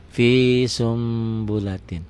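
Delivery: SUMBULATIN with a long, faint nasal m; slow level hold on the M; iklab reading. Shown as a demonstration. Teacher repeats the final reading, long held m. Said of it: "Bunyi N-nya berubah menjadi M".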